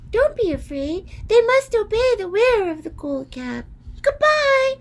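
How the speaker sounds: very high squeaky reassuring mouse; a tiny queen soothing the girl. A narrator's reading. Mouse queen reassuring Dorothy, very high squeaky.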